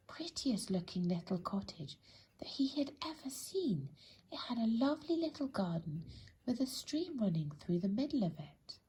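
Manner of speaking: plain reading voice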